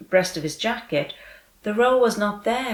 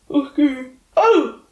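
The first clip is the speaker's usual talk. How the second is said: a high, loud gasp of fear; frightened cry; 7 semitones higher, 7 dB louder, slower than usual. A narrator's reading. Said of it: "cried the eldest".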